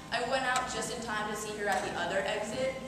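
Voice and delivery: ordinary delivery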